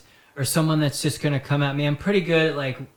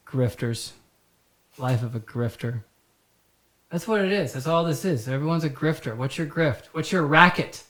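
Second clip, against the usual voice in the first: usual speaking voice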